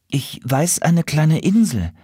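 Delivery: shy. A narrator's reading. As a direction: venture it slowly and shyly. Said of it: "sagte der Bunte schüchtern".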